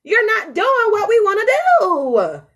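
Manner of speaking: slow and building to loud high scolding; heart nagging. Retold or imitated. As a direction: lay into the brain, harder each line. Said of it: "my heart is constantly like".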